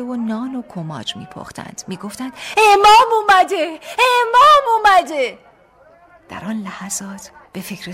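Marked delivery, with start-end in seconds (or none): 2.6-5.4 high, loud, slow calling out of the crowd; joyful shout. A narrator's reading. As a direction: shout the good news to the village. Villagers shouting the news.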